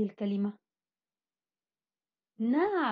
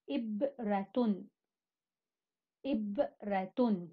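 إبرة said slowly twice, syllables drawn out; slow syllable demo; much slower than usual. Shown as a demonstration. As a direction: stretch إبرة so the syllables show. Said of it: "هنا حرف الباء ساكن". (إبرة بنطق بطيء واضح)

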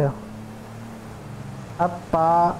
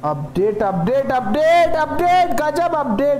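excited shouting; 8 semitones higher, slower than usual. Real, not acted. Streamer hyped about the new game update, shouting. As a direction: shout it out, hyped beyond control. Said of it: "क्यों चिल्ला रहा हूँ भाई?".

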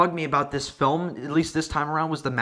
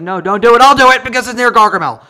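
loud and fast, hammed-up alarm; mock heroic shout; 5 semitones higher, 10 dB louder, faster than usual. The hero shouting to stop Clumsy, loud fast mock alarm. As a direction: bark it out like an overeager hero. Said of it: "And he's like".

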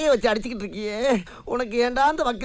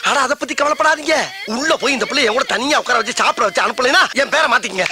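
normal talking voice

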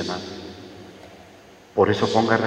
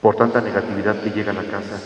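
ordinary talk

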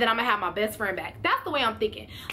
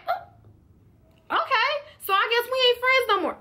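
much higher, stung and incredulous; hurt disbelief; 11 semitones higher, slower than usual. Retold or imitated. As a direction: squeak out the hurt, voice jumping way up. Hurt reaction to friend's accusation.

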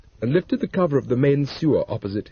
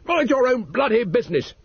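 curt, higher mock-indignant husband; rude retort; 10 semitones higher than usual. A narrator's reading. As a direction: snap back at the officer.

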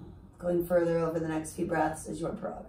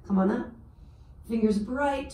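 ordinary talk, nothing marked

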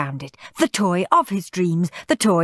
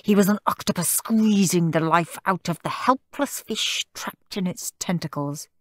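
ordinary delivery, nothing marked

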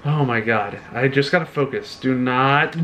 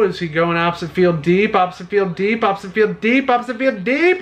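building excitement; 5 semitones higher than usual. Real, not acted. Hoping the hit goes deep.

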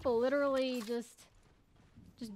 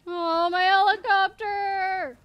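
slow, drawn-out, louder mock lament; mock wail; 6 semitones higher, 8 dB louder, much slower than usual. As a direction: wail over the lost helicopter, hammed up. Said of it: "I'm gonna cry".

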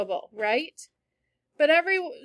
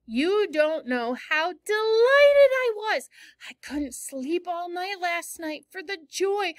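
higher, louder, bursting with joy; delighted Anne; 5 semitones higher, 5 dB louder than usual. Acted. Teacher reading Anne to her class, delighted and gushing. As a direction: gush over the delight. Reads Anne's delight aloud.

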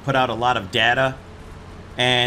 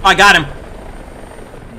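excited shout; 6 semitones higher, 8 dB louder, much slower than usual. Real, not acted. Triumphant after hitting enemy.

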